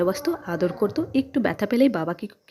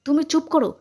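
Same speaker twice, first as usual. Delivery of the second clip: higher, sharp rebuke; scolding; 7 semitones higher than usual. A narrator's reading. Man telling off his sister-in-law, scolding. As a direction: silence them with a sudden raised pitch. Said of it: "ধমক দিয়ে বলল".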